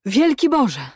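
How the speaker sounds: loud, high outcry; exclaiming. A narrator's reading. Prisoner learns he will be freed, exclaims loudly. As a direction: burst out in astonished joy. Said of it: "wykrzyknął Aleksander".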